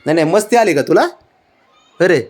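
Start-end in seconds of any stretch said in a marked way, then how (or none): none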